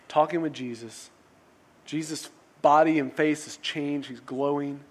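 ordinary talk

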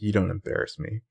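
softly, coolly delivered retort; cold. Retold or imitated. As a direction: say it quietly, with a mild imitated coldness. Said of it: "she says coldly".

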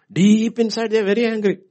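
loud, high preaching shout; preacher shouting about anger. Acted. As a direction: hammer it home.

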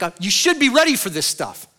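high, loud exasperated rebuke; angry scolding. Retold or imitated. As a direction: scold the church like a frustrated apostle. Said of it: "And he was angry at them".

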